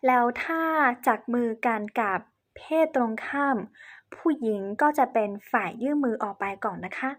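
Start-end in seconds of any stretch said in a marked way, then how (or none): none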